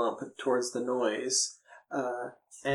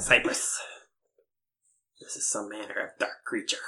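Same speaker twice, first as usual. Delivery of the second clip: raspy whisper then low creature voice; whispering lizard creature; kobold voice; 4 semitones lower than usual. Acted. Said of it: "kobolds whispers to you".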